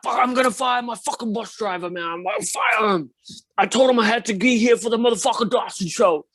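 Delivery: angry put-on voice of a famous drummer. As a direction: vent at the bus driver like an irate drummer. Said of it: "that's my best [name] impersonation".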